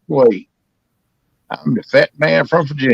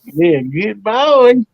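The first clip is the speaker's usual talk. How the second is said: slow higher praise to a dog; 4 semitones higher, much slower than usual. Acted.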